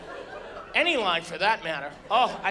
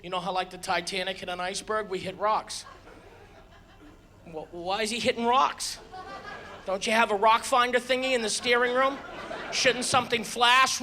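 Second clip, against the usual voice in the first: usual speaking voice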